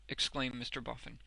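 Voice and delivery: usual speaking voice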